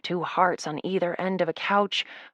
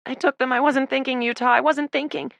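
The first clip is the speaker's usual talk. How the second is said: tearful panic; 7 semitones higher than usual. Acted. Tearful confession.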